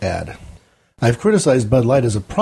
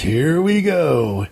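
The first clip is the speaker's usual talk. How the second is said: higher, very slow stretched-out catchphrase; drawn-out party call; 4 semitones higher, much slower than usual. Retold or imitated. Jefferson arriving with beer to start the party, drawn-out call. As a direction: imitate a reveler's long rallying call.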